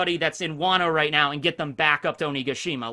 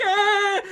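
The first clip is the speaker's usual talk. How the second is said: high-pitched hammed-up bawl; mock crying wail. Soiled Orochi in the corner, mock wailing. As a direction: imitate a crybaby's long high wail. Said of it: "he's crying, you know, he's soiled himself".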